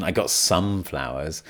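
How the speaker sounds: "some" held long and emphasized; stress on some. Shown as a demonstration. Teacher demonstrates emphasizing some with pronunciation, stress on "some". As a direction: make "some" stand out, hinting at too few. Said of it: "If you emphasize some, especially with your pronunciation".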